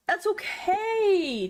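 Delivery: mock teary reassurance. Real, not acted. Consoling self over failed couch.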